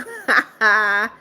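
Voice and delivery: short burst of laughter; real laugh. Real, not acted. Streamer amused that a viewer quit counting, laughing. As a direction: break into a quick, genuine laugh.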